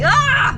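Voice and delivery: sudden high shriek; startled scream at a bump; scared shriek. Real, not acted.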